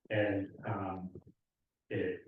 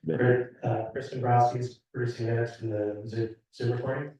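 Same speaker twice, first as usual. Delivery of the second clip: louder repeat for the listeners; louder, slightly raised pitch, repeating on request; speaking up; 2 semitones higher, 5 dB louder than usual. Real, not acted. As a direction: raise your voice to be heard across the room. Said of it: "Could you speak up a little?".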